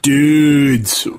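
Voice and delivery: laid-back California surfer dude; California stoner accent. Pretend. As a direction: drawl it like a chill California stoner. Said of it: "Here's a preview of California".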